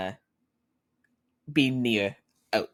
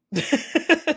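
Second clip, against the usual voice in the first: high dismissive laugh; 5 dB louder than usual. Acted. Dad laughing off the question.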